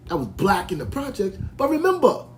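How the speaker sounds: normal talking voice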